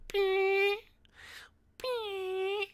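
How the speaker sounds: very high thin squeak, fading out; squeaky fart noise. Retold or imitated. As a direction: squeeze out a tiny high whistle. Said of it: "your farts are just gonna be like".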